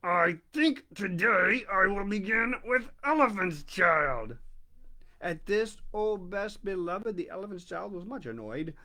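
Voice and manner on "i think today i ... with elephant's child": crocodile speaking through clenched teeth; through his teeth. A narrator's reading. Crocodile through his teeth, gripping the nose. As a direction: grind it out between teeth. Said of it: "between his teeth like this".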